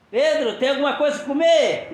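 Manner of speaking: loud, high call across water. Retold or imitated. Jesus calling to Peter from the shore, loud. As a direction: shout out to him from the shore.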